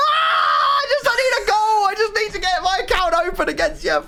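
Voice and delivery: high, crying shout of defeat; despairing wail. Real, not acted. Gamer losing half his squad, wailing and shouting. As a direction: wail like it's all lost.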